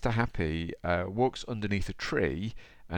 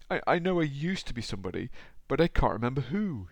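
high, plaintive storybook voice; sniffly and sad; 7 semitones higher, 3 dB louder than usual. A narrator's reading. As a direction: whine it forlornly, as if near tears. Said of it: "he sniffed".

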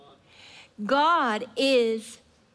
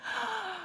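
awed gasp; 9 semitones higher, 9 dB quieter than usual. Acted. Awe before describing God.

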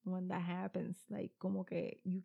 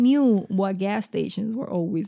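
loud; 14 dB louder than usual. Real, not acted. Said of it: "You're mad loud right now".

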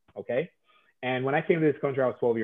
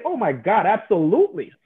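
higher, louder gushing agreement; enthusiastic client; 6 semitones higher, 5 dB louder than usual. Acted. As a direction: play the client eagerly saying yes. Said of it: "Chris is gonna say".

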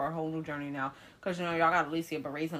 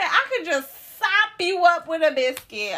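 high, loud, drawn-out mock gushing; imitating a stranger fawning over a baby; gushing lady passerby; 9 semitones higher, 11 dB louder, slower than usual. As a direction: coo it big and slow with relish. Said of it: "She said".

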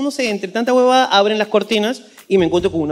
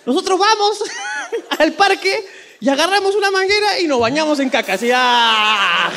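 giggling high through the words; can't stop laughing; 8 semitones higher than usual. Acted. Telling how Peruvians bathe in sewage while laughing hard.

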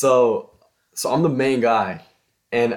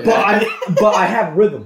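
laughing; 9 semitones higher, 7 dB louder than usual. Real, not acted. Laughing self-defence.

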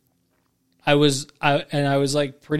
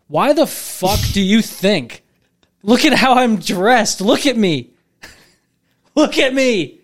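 higher, louder, slow and indignant; mock exasperated rant; 7 semitones higher, 6 dB louder, slower than usual. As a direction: drag it out, fed up and half laughing. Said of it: "I'm like".

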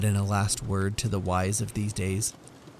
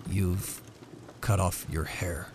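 lower, slow and dazed; slow, puzzled Jim; 3 semitones lower, 3 dB quieter, slower than usual. Acted. Husband staring at wife's short hair, slow and lower. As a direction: struggle to take it in. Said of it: "asked Jim laboriously".

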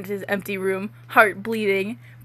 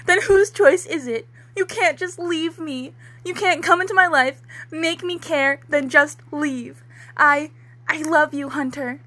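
put-on higher, louder teen-drama pleading; soap-opera breakup wail; hammed-up heartbroken plea; 6 semitones higher, 4 dB louder than usual. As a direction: beg him not to leave, overacted. Dramatic fanfic plea read aloud.

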